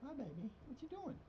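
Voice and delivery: soft, high-pitched cooing. Real, not acted. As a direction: greet the cat in a tiny, tender voice. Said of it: "I was talking to my cat".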